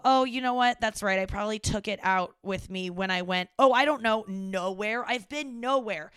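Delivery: mock agreement dripping with scorn; sarcastic retort. Daughter sarcastically shouting back at her dad over the piece.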